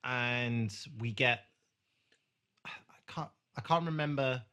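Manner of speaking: usual speaking voice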